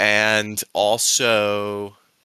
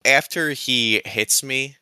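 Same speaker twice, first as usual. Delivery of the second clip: ordinary talk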